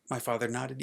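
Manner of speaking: usual speaking voice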